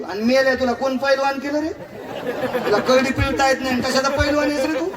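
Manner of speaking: high-pitched acted telling-off; comic old woman's indignant rebuke; mock scolding.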